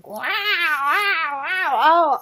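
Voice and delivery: mock gushing. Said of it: "of women".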